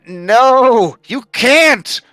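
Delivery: high, loud desperate protest, voice pushed way up; crying out. Acted. Nolan cries out as his book is drowned, high and loud. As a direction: yell it like everything he worked for is slipping away. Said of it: "he cries out".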